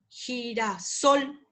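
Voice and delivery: "girasol" slowly, weight on final SOL; slow demonstration of "girasol", last syllable stressed; prosodic stress. Shown as a demonstration. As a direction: draw out "girasol" and land on SOL. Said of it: "esos son palabras con acento prosódico".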